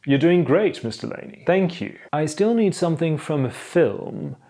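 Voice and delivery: ordinary delivery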